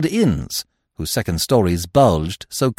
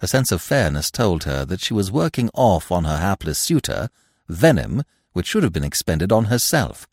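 ordinary delivery, nothing marked

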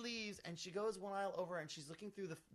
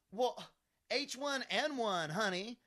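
higher, louder mimicked customer; indignant justifying; 6 semitones higher, 6 dB louder than usual. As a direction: insist on it, mimicking her raised voice.

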